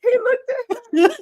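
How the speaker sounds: fast, high, laughing narration; laughing through it. Real, not acted. Host narrates while still laughing hard. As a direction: push the words out between laughs.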